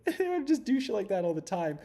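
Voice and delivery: a laugh riding the whole line. Real, not acted. Admitting his crazy swimming habits, laughing. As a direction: chuckle through it, amused at yourself.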